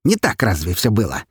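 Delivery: high snide taunt. Acted. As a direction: needle him with a sly jab. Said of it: "ехидно поинтересовался скелет".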